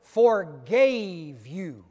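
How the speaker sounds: "forgave you" slow and loud; stress on forgave. Shown as a demonstration. Master reminding the servant he forgave him, slow and heavy. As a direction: hammer "forgave" slowly. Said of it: "circle it".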